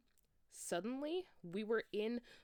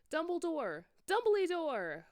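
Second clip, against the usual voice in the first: much higher, loud frantic calling; yelled; 11 semitones higher, 9 dB louder than usual. Acted. Scared students calling for Dumbledore, high frantic yell. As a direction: call for him desperately up the stairs. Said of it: "we both yelled".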